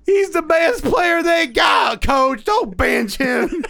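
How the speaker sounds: high, drawn-out mock holler; pleading with coach. Mock fan begging coach not to bench Henry, pleading holler.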